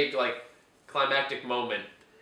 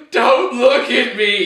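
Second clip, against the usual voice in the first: slow, louder, hammed-up wail of don't look at me; mock breakdown; 5 semitones higher, 10 dB louder, much slower than usual. Mocking the villain breaking down in tears. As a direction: stretch it out like he is falling apart. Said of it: "He starts breaking down".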